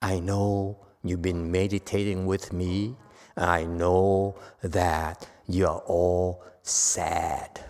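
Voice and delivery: slow, soft, gentle goodbye; tender farewell. Acted. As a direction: let them go kindly. The master's farewell to disciples.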